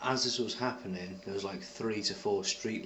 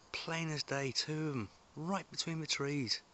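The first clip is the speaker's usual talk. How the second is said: higher, thrilled pointing out; excited; 7 semitones higher than usual. Real, not acted. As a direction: point them out, thrilled at finally catching them. Excitedly spotting the orbs.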